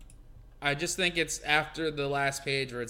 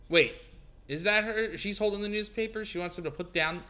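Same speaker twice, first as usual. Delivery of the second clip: ordinary talk, nothing marked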